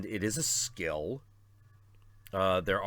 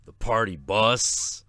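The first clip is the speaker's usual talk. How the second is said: party dude voice; 3 semitones lower, 7 dB louder than usual. Retold or imitated. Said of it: "he's like".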